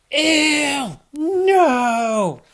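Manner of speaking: high, loud outraged shriek of disgust; mad cry. Acted. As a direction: Hannah falls in mud and yelps. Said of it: "She was so mad".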